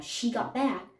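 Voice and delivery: normal talking voice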